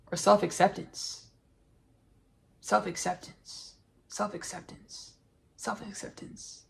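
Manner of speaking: fading echo from spoken to unvoiced whisper. Repeating word, fading to whisper.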